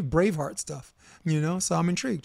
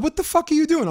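much higher, mock outraged quote; quoting Stringer Bell; 11 semitones higher than usual. Friend reenacts a Wire scene, mock outraged and high. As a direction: hams up the angry question. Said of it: "He said".

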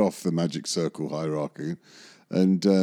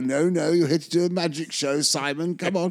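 mock excited [name]; 8 semitones higher than usual. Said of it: "And I'd go".

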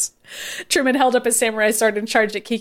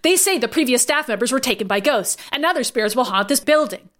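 ordinary delivery, nothing marked

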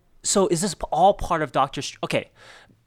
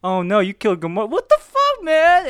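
mock panicked Quill, pitch rising to a yell; Quill freaking out; 3 semitones higher, 6 dB louder than usual. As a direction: play Quill losing it over Gamora. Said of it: "Quill's like".

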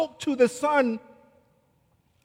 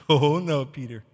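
usual speaking voice

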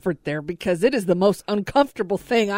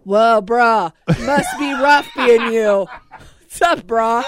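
louder, laughing bro-speak imitation; mock Gen Z bro; 5 dB louder than usual. Host mimics co-host's bro slang with a caller, laughing. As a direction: copy his bruh talk to the caller, teasing. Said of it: "same thing with the Am I the Bad Guy".

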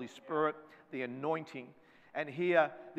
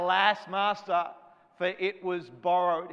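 crying out; 3 semitones higher, 6 dB louder, slower than usual. Retold or imitated. Young prophet crying to his master over the lost axe head, loud slow cry. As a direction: wail it out, drawing each word long. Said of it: "He cries out".

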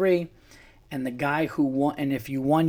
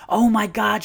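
higher, louder retold astonishment; amazed recognition; 8 semitones higher, 5 dB louder than usual. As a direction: imitate her delighted surprise. Retelling judge's amazed recognition.

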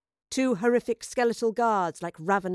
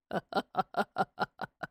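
villainous chuckle; 5 dB quieter than usual. A narrator's reading. Scarred man emerging from the portal, chuckling. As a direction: chuckle slowly and quietly to yourself. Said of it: "He was chuckling".

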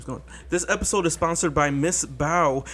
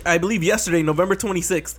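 ordinary talk, nothing marked

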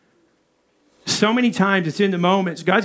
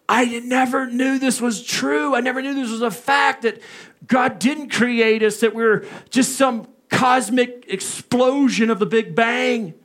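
lightly voiced awed student, slowed; a hint of a shocked kid realizing it; stunned students; 4 semitones higher than usual.

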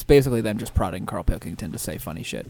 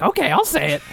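mock eager Ricky; 13 semitones higher than usual. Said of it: "Ricky was like".

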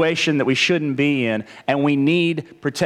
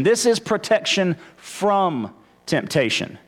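"from" set apart by pauses; stress on FROM; 2 semitones higher than usual. Shown as a demonstration. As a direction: lean on "from", then pause before "temptation". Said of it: "I'm emphasizing from here".

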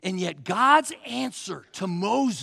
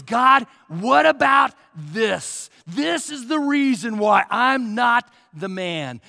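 Moses crying out loud to God, slowly on the first words; a desperate, loud plea to God; pleading shout; 4 semitones higher, 9 dB louder, slower than usual. Retold or imitated. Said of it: "He says".

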